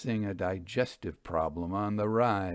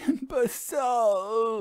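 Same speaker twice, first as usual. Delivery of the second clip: much higher, drawn-out scornful cry; the widow scorns the medium, slow exasperated insult; exasperated groan; 11 semitones higher, slower than usual. Acted. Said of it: "Missus Dunwittle groaned".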